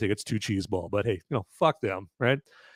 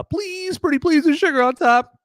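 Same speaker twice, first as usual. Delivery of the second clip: very high-pitched mock pleading; begging studio; 4 dB louder than usual. Playing Lionsgate begging for a sequel, high mock plea. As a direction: beg in a squeaky, over-sweet voice. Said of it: "Lionsgate's gonna be like".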